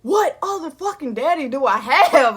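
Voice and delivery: loud, slow, incredulous outburst; answering a clueless commenter, loud and incredulous; exasperated retort. Retold or imitated. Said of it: "I'm just like".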